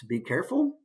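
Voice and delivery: ordinary delivery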